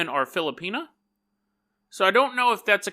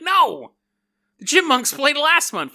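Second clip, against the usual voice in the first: higher, louder objection; indignant cop; 9 semitones higher, 6 dB louder than usual. Acted. Cop loudly correcting a mistaken concert theory. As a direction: cut in sharply to correct them.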